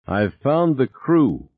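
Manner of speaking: very slow, deliberate announcement; slow reveal. Acted. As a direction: let the discovery sink in slowly. Beth's eerie revelation.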